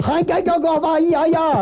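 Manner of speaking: high-pitched mock gibberish, imitating; Andrew Dice Clay. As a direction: crude comic's put-on nonsense chatter. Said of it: "come on, Dice".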